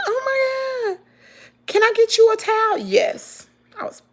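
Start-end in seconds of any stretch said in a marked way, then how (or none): 0.1-2.8 high, put-on voice of a fretting woman; mock-distressed dog owner. Dog owner offering the bleeding carrier a towel, mock-distressed. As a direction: mimic her dismay, pitched high, quickening into the question.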